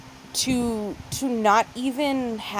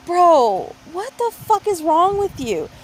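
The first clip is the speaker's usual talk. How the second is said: exasperated; 7 semitones higher, 4 dB louder, slower than usual. Retold or imitated. Retold exasperation.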